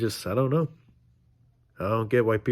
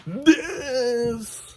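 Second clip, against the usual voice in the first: slow high drawn-out 'this'; exaggerated price jump; 13 semitones higher than usual. Vlogger mocking prices, slow high stretched 'this'. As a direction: stretch the word way up for the big price.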